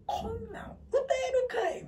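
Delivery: high, slow, half-crying complaint; whiny protest. Retold or imitated. Alone with the hard song, whining high and slow. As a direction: wail that it's impossible to sing.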